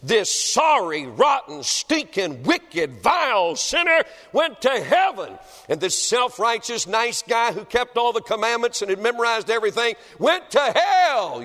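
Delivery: thundering revival preaching; preaching at full force. Acted. Preacher to congregation, full-force revival preaching.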